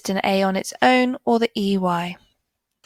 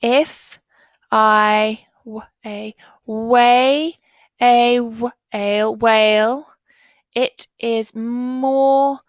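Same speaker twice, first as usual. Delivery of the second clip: slow, louder, word-by-word sentence read; slow choral reading; 3 dB louder, much slower than usual. Shown as a demonstration. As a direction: guide the class through each word. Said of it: "Now let's read it together".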